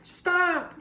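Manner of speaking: high panicked yell. A narrator's reading. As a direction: shout it to stop the pot. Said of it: "yelled Big Anthony".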